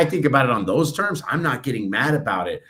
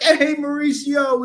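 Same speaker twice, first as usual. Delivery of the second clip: much higher, slow, sing-song hello; cheery greeting; 12 semitones higher, 4 dB louder, slower than usual. Retold or imitated. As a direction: greet him like the morning is a party. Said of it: "I'm like".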